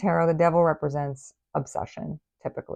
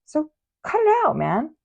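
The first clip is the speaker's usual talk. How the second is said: mock scolding; 8 semitones higher than usual. Scolding the viewer playfully.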